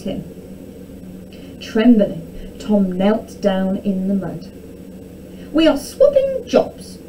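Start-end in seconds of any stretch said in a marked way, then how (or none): 5.5-7.0 high-pitched imperious announcement; princess announcing. A narrator's reading. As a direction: proclaim it high as a storybook princess. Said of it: "announced Princess Arabella".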